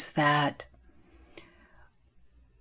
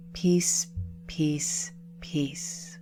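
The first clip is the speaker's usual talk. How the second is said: softly spaced words, each quieter; closing blessing; 6 dB quieter, much slower than usual. Real, not acted. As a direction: let each word fade towards a whisper.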